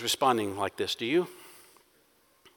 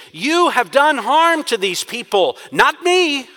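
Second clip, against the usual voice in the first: raised, slow, accusing voice; frustrated accusation; 9 semitones higher, 6 dB louder, slower than usual. Acted. Moses accusing God, frustrated and loud. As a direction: hurl the blame back at heaven. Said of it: "It is a frustrated accusation".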